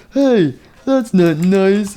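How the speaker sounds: high, slow scolding voice; indignant character. Acted. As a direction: draw it out high in protest. Toy Freddy scolds the attacker.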